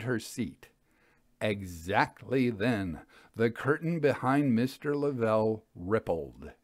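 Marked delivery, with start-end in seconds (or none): none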